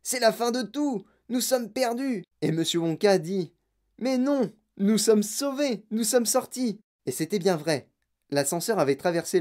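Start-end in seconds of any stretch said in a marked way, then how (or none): 0.0-2.2 high, despairing wail; high-pitched doom-laden panic; panicked yell. A narrator's reading. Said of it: "hurla grand-papa Joe". 4.0-6.8 high, slow triumphant cry; high, stretched-out delight; jubilant reassurance. A narrator's reading. Wonka joyfully contradicts the panic.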